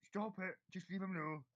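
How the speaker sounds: hammed-up ogre shouting to stop; ogre telling off. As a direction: bound ogre yells at the laughing one. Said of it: "He goes".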